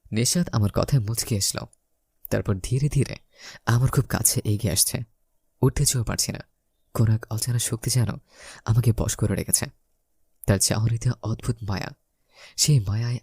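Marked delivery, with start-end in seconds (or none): none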